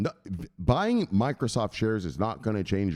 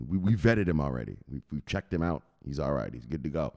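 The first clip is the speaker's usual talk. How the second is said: ordinary delivery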